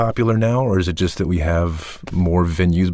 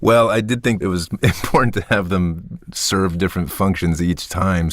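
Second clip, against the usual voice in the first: usual speaking voice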